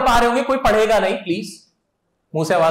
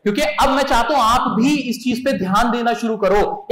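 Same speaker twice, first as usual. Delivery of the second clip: ordinary delivery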